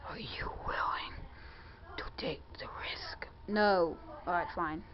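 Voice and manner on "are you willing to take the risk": whispered dare. Pretend. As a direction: hush it like a secret challenge. Dramatic dare, hushed.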